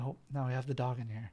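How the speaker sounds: usual speaking voice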